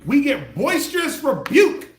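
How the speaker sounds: loud, slow, drawn-out boom. Acted. Echoing the definition to the room, booming. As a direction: belt the phrase out slow.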